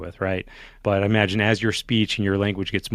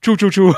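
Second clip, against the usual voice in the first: higher, louder choo choo like a train; 10 semitones higher, 8 dB louder than usual. Retold or imitated. Roommate on the phone to the guards, train-like choo choo. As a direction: chug it out like a toy train. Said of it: "picks up the phone and goes".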